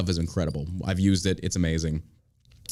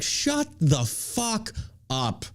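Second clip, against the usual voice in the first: very slow, higher, every word separated; exasperated retort; 6 semitones higher, 4 dB quieter, much slower than usual. Retold or imitated. Fed-up buyer answering salesman's text, slow exasperation. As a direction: drag out each word in exasperation.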